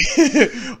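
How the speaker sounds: high, loud laugh; loud cackle of laughter; burst of laughter. Real, not acted. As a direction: laugh out loud mid-sentence. Laughing mid-story.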